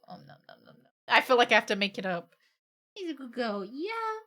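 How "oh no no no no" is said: whispered protest. Acted. Voicing the pet's protest.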